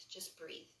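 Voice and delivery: a soft whispered reminder to breathe; whispered cue. Acted. Guiding breath in yoga class.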